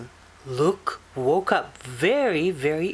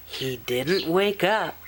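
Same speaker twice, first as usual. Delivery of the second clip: usual speaking voice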